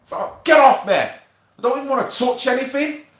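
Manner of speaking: disgusted outburst. Retold or imitated. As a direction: recoil from the stink. Voicing himself in stinking toilet.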